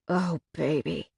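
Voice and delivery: soft, low rasp. A narrator's reading. Jackson comforting the heroine, soft rasp. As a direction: rasp it out tenderly. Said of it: "Jackson rasps".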